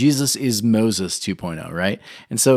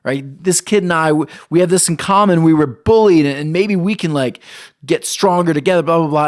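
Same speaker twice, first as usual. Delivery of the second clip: higher, louder, excited fantasy; mock daydream; 6 semitones higher, 5 dB louder than usual. Pastor acting out his schoolboy daydream, bright and excited. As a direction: gush like a kid imagining a best friend. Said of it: "I start daydreaming about".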